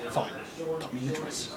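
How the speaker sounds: soft, low grudging surrender; acted-out defeated reply; resigned giving in. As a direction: give in quietly.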